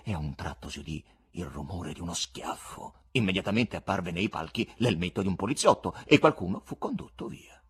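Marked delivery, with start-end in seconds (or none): none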